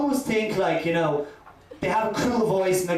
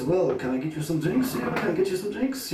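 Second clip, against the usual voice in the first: waiter. Retold or imitated. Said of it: "the waiter came over and says".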